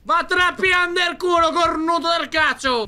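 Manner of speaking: relieved, shouting, half crying; triumphant yell. Real, not acted. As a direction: yell of relief after escaping. Escaped, cursing monster. (urlo di sollievo)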